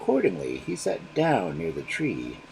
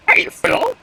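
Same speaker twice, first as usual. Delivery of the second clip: much higher and louder, an outraged bird; scolding cry; 12 dB louder than usual. Acted. Crow scolding the foolish magpie, outraged cry. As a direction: snap it at her in disbelief. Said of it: "cried the crow".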